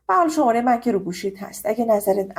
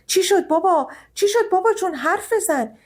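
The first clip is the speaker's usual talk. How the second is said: much higher, alarmed pleading to a collapsing father; panicked calling; 9 semitones higher than usual. Acted. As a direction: call out in fright to the father. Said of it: "با صدای نیما که میگفت".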